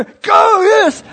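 very high, loud fan's shout; excited cheering. Retold or imitated. Kiwi pastor re-enacting cheering on the English skater, excited shouting. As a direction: re-enact yelling at the race on TV. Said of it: "we go".

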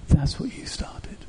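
a soft breathy whisper; whispered aside. Real, not acted. Preacher whispering to the couple standing up, soft and hushed. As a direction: drop to a whisper to make it land.

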